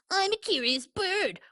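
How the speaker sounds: pigeon parroting the duckling in a snide sing-song; mocking imitation. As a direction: mimic him to make fun.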